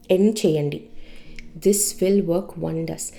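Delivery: ordinary delivery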